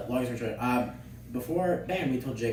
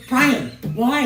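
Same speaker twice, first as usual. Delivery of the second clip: exasperated teacher; 10 semitones higher, 7 dB louder than usual. Retold or imitated. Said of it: "Miss McDougal goes".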